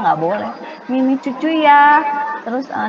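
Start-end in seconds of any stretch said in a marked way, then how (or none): 0.9-2.0 high, loud mock baby-talk lisp. Shown as a demonstration. Parenting speaker mimics parents lisping to babies, babyish lisp. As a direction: coo it in babyish lisped words, pitched well up. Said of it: "dia dicadel-cadelin gitu ngomongnya".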